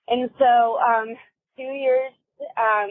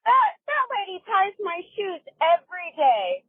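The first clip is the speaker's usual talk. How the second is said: confused grandma; 9 semitones higher than usual. Retold or imitated. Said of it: "And she goes".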